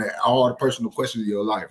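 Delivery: normal talking voice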